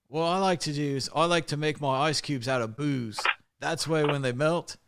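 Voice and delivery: put-on drawn-out British accent; hammed-up British rock star, stretching the last words. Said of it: "when you do a British accent".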